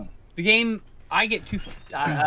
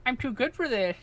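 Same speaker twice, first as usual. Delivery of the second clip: ordinary talk, nothing marked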